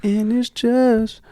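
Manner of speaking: high, slow singing-style voice. Retold or imitated. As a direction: draw it out high like a diva. Imitating [name] singing high.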